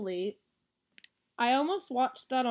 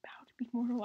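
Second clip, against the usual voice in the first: breathy menacing whisper; 5 dB quieter, much faster than usual. Pretend. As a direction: hiss it like a villain. Same command whispered.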